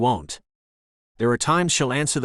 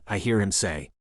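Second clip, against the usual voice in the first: usual speaking voice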